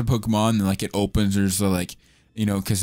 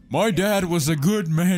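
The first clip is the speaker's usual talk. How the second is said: voicing character; 8 semitones higher than usual. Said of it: "He's like".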